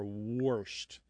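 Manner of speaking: "warshed" said as "worshed"; dialect warshed. Shown as a demonstration. Host demonstrating dialect variants, intrusive-R pronunciation. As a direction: say "warshed" with an R before the sh. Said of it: "you do hear".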